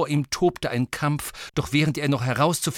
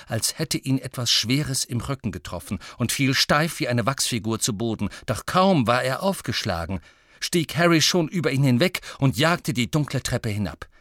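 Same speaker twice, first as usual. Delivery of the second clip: ordinary delivery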